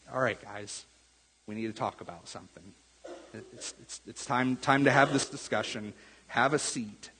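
soft, low, hushed start to a hard talk; tender, like a grandpa sitting you down; gentle tone of love. Retold or imitated. John gently telling the church to sit down and talk, tender and hushed. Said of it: "He starts with this tone of love".

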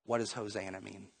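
hushed, low, confused question; puzzled aside. Acted. Acted puzzled aside amid cheering.

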